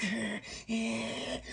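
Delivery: high, soft, drawn-out strained jeer; mock gloating. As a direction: savour the curse as it works. Relishing the spell he cast.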